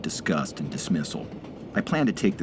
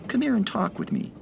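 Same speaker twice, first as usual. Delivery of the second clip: easy vernacular. A narrator's reading. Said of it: "in an easy vernacular".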